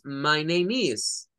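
very slow "My name is"; model phrase. Shown as a demonstration. Teacher shows students how to introduce themselves. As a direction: spell it out in sound.

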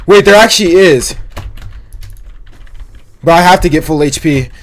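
ordinary delivery

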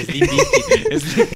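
high, loud, stretched-out squeal of laughter; helpless mocking laughter. Real, not acted. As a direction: shriek the words through real laughing. Mocks the mangled band name, laughing.

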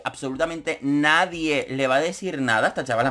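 ordinary talk

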